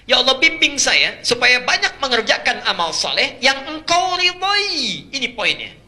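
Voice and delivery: suara sangat tinggi dan lantang; khotbah berapi-api. Acted. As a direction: berseru penuh desakan kepada jemaah. (seruan doa dan khotbah bernada tinggi)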